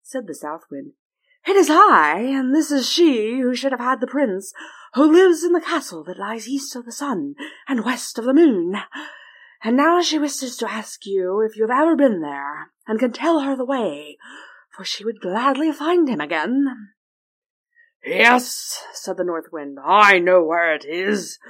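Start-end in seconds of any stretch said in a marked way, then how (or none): 1.5-17.0 loud, higher wind spirit; eager, booming wind voice; South Wind voice. Acted. South Wind addresses North Wind. 18.0-18.8 North Wind voice. Acted.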